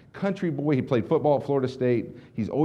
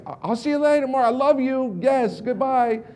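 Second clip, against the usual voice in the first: gushing goodbye; 10 semitones higher than usual. Retold or imitated. Saying goodbye to the speaker Mark, gushing and starstruck. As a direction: gush like a starstruck fan.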